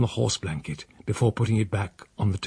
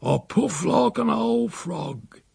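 higher, slow, short of breath; wheezy old uncle; 9 semitones higher, slower than usual. Acted. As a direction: puff out each word like a tired old man. Breathless old man complains.

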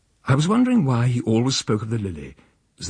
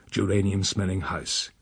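usual speaking voice

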